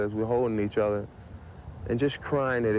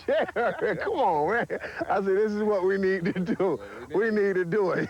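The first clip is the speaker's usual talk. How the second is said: excited pleading; 11 semitones higher than usual. Retold or imitated. Retelling how he urged Jerry to sign Deion, high and pleading. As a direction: push hard to close the deal, voice way up.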